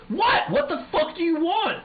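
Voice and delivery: high, loud, fed-up snap at the caller; irritated outburst. Retold or imitated. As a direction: bark back, annoyed at being bothered. Annoyed answering work call.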